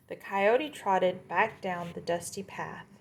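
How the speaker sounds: usual speaking voice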